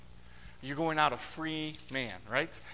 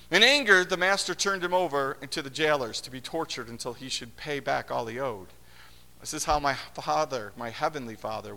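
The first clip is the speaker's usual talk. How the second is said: louder, declaiming Bible reading; a loud, formal reading of the parable; scripture reading; 5 dB louder than usual. Acted. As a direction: read the passage out to the congregation.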